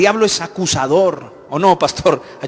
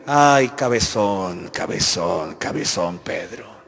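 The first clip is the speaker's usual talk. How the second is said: fond scolding; 10 semitones lower, slower than usual. Acted. Jesus scolding Peter fondly.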